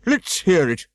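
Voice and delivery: Humpty Dumpty scolding. A narrator's reading. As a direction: snap at the girl from the wall.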